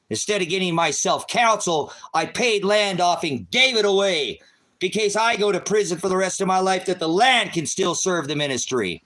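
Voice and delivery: screaming in rage, voice straining high and loud; furious yelling. Real, not acted. Ranting at his critics, furious screaming. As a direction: rant at them at the top of your lungs.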